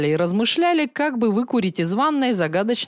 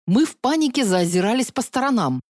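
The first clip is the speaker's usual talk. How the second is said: usual speaking voice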